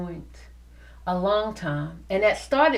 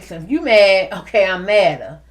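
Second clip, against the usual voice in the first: mock matching anger; 2 semitones lower, 8 dB louder than usual. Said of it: "the old me would have been like".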